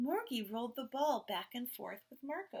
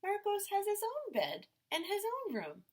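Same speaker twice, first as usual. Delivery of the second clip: parents' voice; 11 semitones higher than usual. A narrator's reading. Parents answer the kids.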